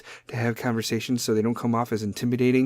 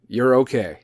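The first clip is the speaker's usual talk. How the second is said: firm, matter-of-fact, falling; stern own voice; 3 dB louder than usual. Shown as a demonstration. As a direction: say it bluntly, no coddling. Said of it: "I'm gonna do it for my voice".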